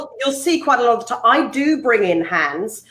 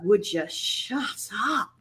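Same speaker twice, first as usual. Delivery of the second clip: soft, slow muttered shut up; snapping at herself; 3 semitones lower, 10 dB quieter, slower than usual. Retold or imitated. Presenter retelling telling herself off, soft and slow. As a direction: scold yourself under your breath. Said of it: "I was just like".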